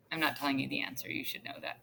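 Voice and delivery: under your breath, barely voiced; hushed aside. Real, not acted. Teacher withholding the answer from students, hushed aside. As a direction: drop to a low, very soft murmur.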